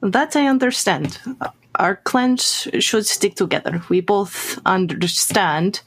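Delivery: put-on Russian-accented appeal to solidarity. Urging clans to stick together, Russian accent. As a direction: make common cause in a Russian accent. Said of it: "German with a Russian accent".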